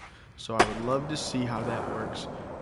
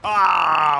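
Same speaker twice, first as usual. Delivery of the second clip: frustrated ah; 6 semitones higher, 14 dB louder than usual. Real, not acted. Missed trick in game.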